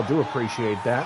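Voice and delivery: low, soft put-on voice; odd put-on voice. Thanking a follower on stream in a low odd voice. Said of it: "weird turn of the accent there".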